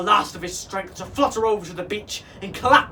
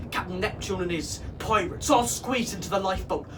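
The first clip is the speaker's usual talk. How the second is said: ordinary delivery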